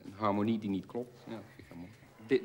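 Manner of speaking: ordinary delivery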